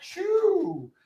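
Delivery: high-pitched whistle "choo" like a toy train; train whistle sound. Acted. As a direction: toot it like a little train whistle. Playful spin sound effect.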